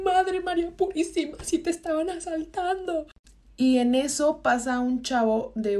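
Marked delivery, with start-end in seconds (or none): 0.0-3.1 high-pitched mock exclamation of alarm; imitated shocked lady. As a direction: mimic a pious woman realizing it was a robbery. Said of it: "la señora empieza con".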